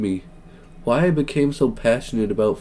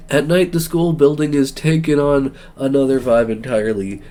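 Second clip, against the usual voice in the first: usual speaking voice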